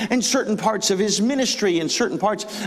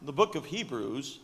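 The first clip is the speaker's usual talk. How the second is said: scholarly voice; 7 semitones lower, 10 dB quieter than usual. Retold or imitated. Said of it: "in their scholarly voice".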